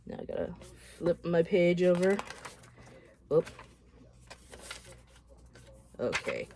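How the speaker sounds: ordinary delivery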